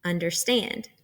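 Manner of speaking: "Understand" with the last syllable stressed; two light syllables, then hit "stand" in "Understand"; un-der-STAND, weight on the end of "Understand"; anapestic stress. Shown as a demonstration. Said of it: "unstressed syllables followed by a stressed syllable".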